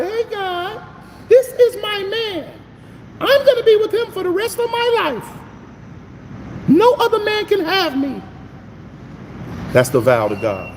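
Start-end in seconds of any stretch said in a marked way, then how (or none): 0.0-8.2 put-on high falsetto girl's voice, far above his own; sweet high-pitched girlfriend vowing to God; Sandy voice. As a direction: play the girlfriend making her vow to God, way up high. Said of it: "That's my Sandy voice".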